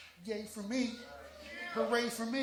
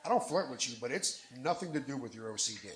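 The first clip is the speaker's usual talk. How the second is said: usual speaking voice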